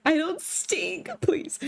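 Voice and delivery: indignant denial. Pretend. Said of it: "V says you stink".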